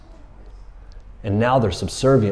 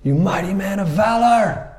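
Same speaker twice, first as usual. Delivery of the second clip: high, booming angel voice, pitched way up; angel yelling; 10 semitones higher than usual. Retold or imitated. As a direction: proclaim it like a heavenly herald. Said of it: "And he yells at him".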